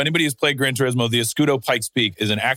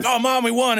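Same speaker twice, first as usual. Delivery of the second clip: mock excited son; 10 semitones higher than usual. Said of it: "I'm like".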